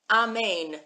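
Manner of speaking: AMEN as a clear ah-MAIN; proper Hebrew pronunciation. Shown as a demonstration. Teacher confirming the proper Hebrew word, AMEN said ah-MAIN. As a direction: pronounce AMEN ah-MAIN, opening on ah. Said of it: "It's the proper Hebrew translation".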